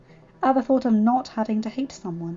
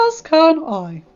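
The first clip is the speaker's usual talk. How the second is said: louder, self-important god; pompous Zeus voice; 5 semitones higher, 8 dB louder, slower than usual. Acted. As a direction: lord it over the others. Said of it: "the pompous voice I used for Zeus".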